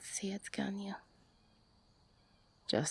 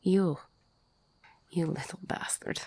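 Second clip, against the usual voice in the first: a single loud burst of blame; loud accusing you; sudden outburst; 2 semitones higher, 4 dB louder than usual. Acted. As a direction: spit the word out at them.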